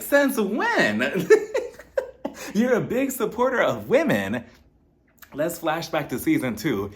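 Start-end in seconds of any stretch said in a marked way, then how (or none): 0.0-1.3 high-pitched mock disbelief; incredulous. Host challenging Lisa's claim about women, incredulous. 2.5-4.4 high, swooping disbelief; incredulous echo. Real, not acted. Host questioning Lisa's support of women, incredulous. Throwing Lisa's claim back at her.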